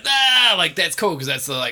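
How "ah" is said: a high, loud mock whoop of an excited crowd; crowd cheer. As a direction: cheer like a hyped audience at the start. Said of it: "And everyone's just like".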